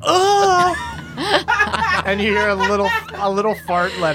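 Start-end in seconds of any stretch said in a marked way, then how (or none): 0.0-0.8 meek grunt. Retold or imitated. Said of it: "just meekly goes".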